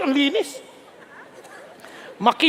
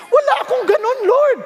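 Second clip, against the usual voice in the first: high, louder wail of inadequacy; protesting Joshua; 8 semitones higher, 4 dB louder than usual. Acted. Joshua objecting to the Lord, high-pitched protest. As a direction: plead helplessly, pitch way up. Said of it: "He was saying, "Lord".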